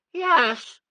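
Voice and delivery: put-on high whiny child's nah; whining child. A narrator's reading. Sissy refusing the question, whining. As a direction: whine it like a sulky girl.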